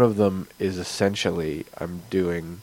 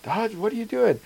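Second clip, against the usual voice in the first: player questioning cat; 13 semitones higher than usual. Pretend.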